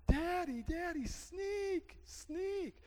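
put-on high, slow toddler voice; little girl calling. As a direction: plead like a scared toddler. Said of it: "she's crying".

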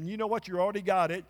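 ordinary delivery

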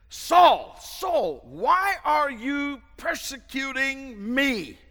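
loud, high calling voice of God; voice from heaven. Acted. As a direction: call out Saul slowly from above. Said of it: "heard a voice saying to him".

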